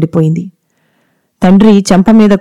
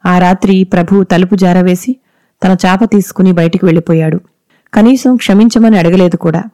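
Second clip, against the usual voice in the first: plain reading voice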